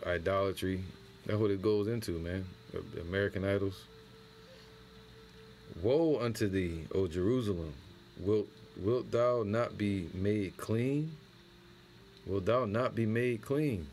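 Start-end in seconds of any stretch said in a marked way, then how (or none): 5.8-13.9 scripture reading. Acted. Reads prophet's lament slowly.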